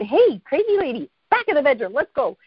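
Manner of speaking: mock bossy. Friend ordering crazy lady to the bedroom, bossy retelling. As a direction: order her out like a pipsqueak turned boss. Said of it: "I kind of bossed her".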